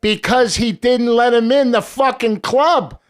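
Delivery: high, loud and slow, hammering each word; loud slow indignant storytelling; outraged emphasis. Real, not acted. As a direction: drive home the outrage, slowly and loudly. Outraged climax of gangster story.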